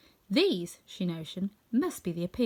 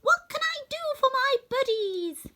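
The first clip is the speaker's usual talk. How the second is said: much higher, tiny mouse queen; squeaky little voice; 4 dB louder than usual. Acted. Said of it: "her squeaky little voice".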